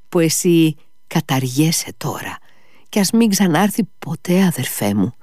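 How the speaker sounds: urgent plea, pitch rising high; pleading Alyosha. A narrator's reading. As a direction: urge your brother to let it go.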